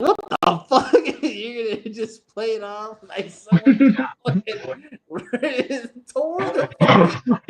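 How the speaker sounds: high laughing outburst; laughing through the words in disbelief. Real, not acted. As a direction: crack up mid-sentence. Laughing at the cousin's cover story.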